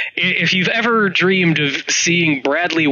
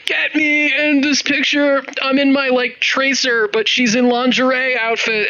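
mock cosplayer; 9 semitones higher than usual. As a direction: beg for the photo like an eager cosplayer. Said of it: "cosplayers being like".

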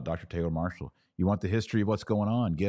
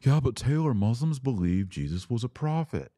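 imagined objector; 5 semitones higher than usual. Retold or imitated.

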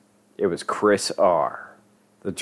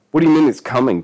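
louder, higher angry challenge; menacing demand; 6 semitones higher, 6 dB louder, faster than usual. A narrator's reading. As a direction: confront him sharply. Dealer confronts Denny in story.

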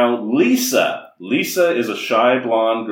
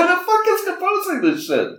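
mock outrage.

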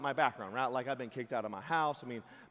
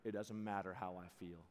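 low calm big guy; 6 semitones lower, 7 dB quieter than usual. Retold or imitated. Big NFL friend calm reply.

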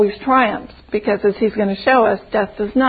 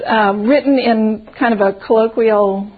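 ordinary delivery, nothing marked

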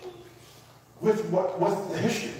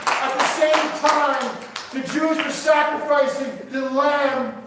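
fervent preaching; 5 semitones higher than usual. Real, not acted. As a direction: hammer each phrase home to the congregation. Preacher builds at full force.

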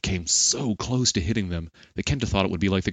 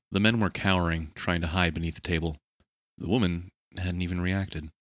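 plain reading voice

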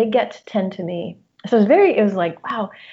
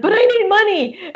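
much higher, a bit louder whiny plea; pleading inner voice; 12 semitones higher, 5 dB louder than usual. Retold or imitated. Anxious inner voice begging her to work, higher and louder. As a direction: squeak it out like the anxious part of you. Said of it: "my little voice would be like".

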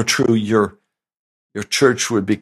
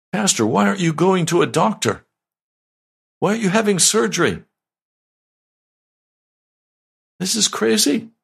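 much higher, fast, incredulous questioning; worried neighbor; 10 semitones higher, faster than usual. Retold or imitated. Neighbor scolding the pastor for skipping surgery, high and incredulous. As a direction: pitch way up like an exasperated neighbor. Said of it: "a neighbor".

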